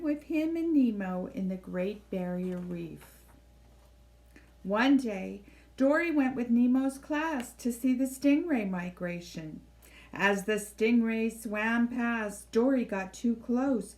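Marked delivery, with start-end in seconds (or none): none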